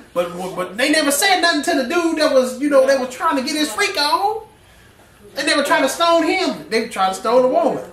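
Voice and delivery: high, loud preaching peak, pushing hard; full-force preaching. Acted. Preacher to his congregation, full-force loud preaching. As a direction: preach it out at the top of the voice.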